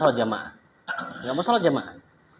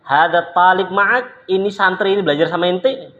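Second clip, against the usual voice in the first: loud demand; 7 semitones higher, 6 dB louder than usual. Retold or imitated. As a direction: demand an answer from the teacher. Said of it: "Langsung ditanya itu".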